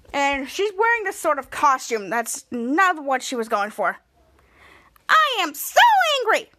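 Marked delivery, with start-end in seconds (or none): 5.1-6.5 high, loud mock-furious whine; angry Piper. Piper sulking over her costume, loud and angry. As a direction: stomp it out like a sulky teen. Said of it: "is all angry".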